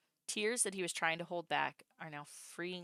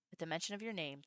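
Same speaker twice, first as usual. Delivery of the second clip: normal talking voice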